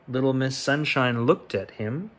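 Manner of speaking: plain reading voice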